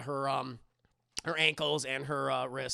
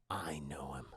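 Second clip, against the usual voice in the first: low menacing murmur; 11 dB quieter than usual. Acted.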